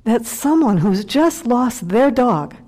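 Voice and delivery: usual speaking voice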